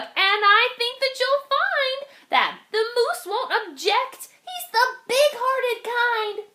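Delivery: high, cheery bug voice; a little bug reassuring the spider. Acted. Bug continues.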